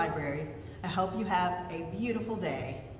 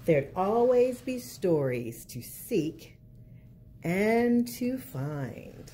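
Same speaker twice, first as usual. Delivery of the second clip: put-on lilting picture-book narration for kids; reading to children; slower than usual. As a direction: read it like storytime at the library.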